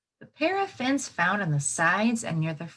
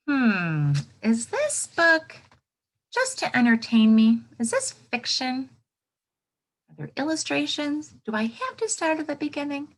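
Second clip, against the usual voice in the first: thinking aloud as reader; 6 semitones higher, 3 dB louder than usual. Shown as a demonstration. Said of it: "I want you to think".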